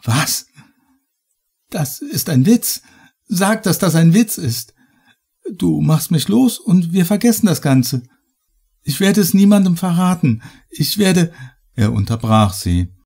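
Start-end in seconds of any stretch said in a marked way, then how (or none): 0.0-11.3 high, urgent pleading; desperate disbelief, pitched well up; stunned, distraught. A narrator's reading. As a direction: plead frantically with a captor. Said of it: "Annabel war fassungslos".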